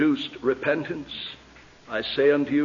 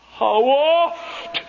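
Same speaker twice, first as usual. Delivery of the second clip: wailing lament; 10 semitones higher, 4 dB louder than usual. Acted. Jesus weeping over Jerusalem.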